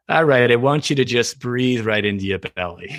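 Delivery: imitated heavy New York-Boston accent; heavy New York-Boston meditation guide; Jon Kabat-Zinn. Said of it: "sort of New York, Boston accent".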